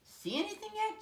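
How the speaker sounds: put-on high, eager little boy; high child's question. A narrator's reading. Jimmy asking Dad if he sees anything, high and eager. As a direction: ask like an impatient kid.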